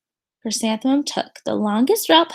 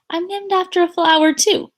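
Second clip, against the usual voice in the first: higher, bright reveal; sweet teacher; 7 semitones higher, 5 dB louder than usual. Acted. Teacher telling the class, sweetly. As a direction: share a happy secret.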